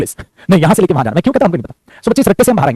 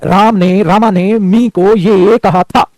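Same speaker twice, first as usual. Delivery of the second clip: slow, drawn-out director's narration; film director narrating; much slower than usual. Acted. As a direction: set up the scene word by word, very slowly. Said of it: "examiner director है". (Film director की तरह धीरे-धीरे)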